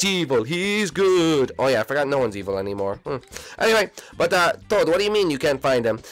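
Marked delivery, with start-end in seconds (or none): none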